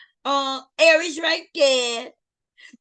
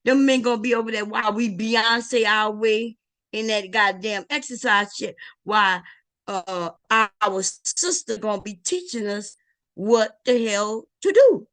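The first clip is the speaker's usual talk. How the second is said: normal talking voice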